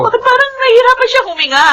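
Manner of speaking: alarmed. Acted. Mother notices baby can't breathe.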